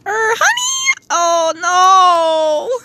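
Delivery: high, drawn-out mock mother voice; mom impression. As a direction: imitate a mom in a slow high squeal. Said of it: "my mom comes in and it's like".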